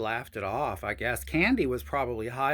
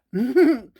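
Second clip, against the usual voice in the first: a loud burst of laughter; real laugh; 9 semitones higher, 10 dB louder than usual. Real, not acted. Laughing at the drunk friend's mix-up, a real loud laugh. Laughs at her own story.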